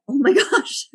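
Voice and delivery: high laughing oh my gosh; startled student laughing in disbelief; shocked. Retold or imitated. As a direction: gasp it out, caught off guard. Her shocked reaction, retold.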